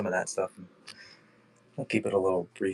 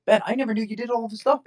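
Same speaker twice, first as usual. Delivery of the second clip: much higher, surprised exclamation to a friend; amazed; 13 semitones higher, 3 dB louder, faster than usual. Retold or imitated. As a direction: gasp it out as a stunned discovery. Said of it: "you're like".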